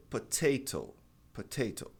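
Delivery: "potato" with both Ts crisply pronounced; British T. Shown as a demonstration. Teacher giving the British pronunciation, both Ts sounded. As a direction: say "potato" keeping each T a true T. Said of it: "primeiro pronunciando o T".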